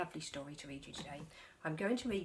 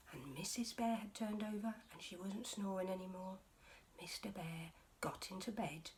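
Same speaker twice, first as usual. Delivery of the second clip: plain reading voice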